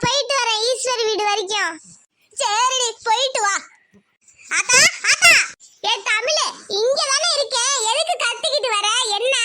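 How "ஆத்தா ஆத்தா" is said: calling out. Acted. Granddaughter storms in calling grandma.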